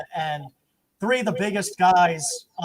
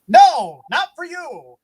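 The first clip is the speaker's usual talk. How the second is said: loud, much higher no, not for you; mock shout; 9 semitones higher, 10 dB louder, much slower than usual. Prejudiced fans rejecting the idea, mock shouting. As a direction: fling it out like a heckler.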